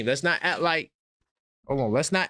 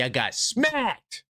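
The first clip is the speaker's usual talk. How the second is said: slow taunt; 5 semitones higher, much slower than usual. Acted. Taunting Boston fans.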